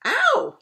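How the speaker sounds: high loud yelp of pain; sharp ow. Acted. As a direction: yelp as the rope hits. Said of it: "he said".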